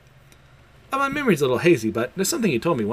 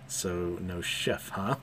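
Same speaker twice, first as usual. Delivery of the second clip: deadpan disappointment; 6 semitones lower, 10 dB quieter, much slower than usual. Retold or imitated. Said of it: "Anya's thinking".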